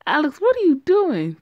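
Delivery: startled shout at a friend stealing; alarmed shout. Acted. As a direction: yell at him in panic. Panicked call as Alex grabs food.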